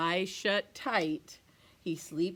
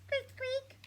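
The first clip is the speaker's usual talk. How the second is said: tiny high squeaky mouse voice; mouse squeak; 5 dB quieter than usual. Acted. Storyteller voicing a mouse in the cold, high squeaky.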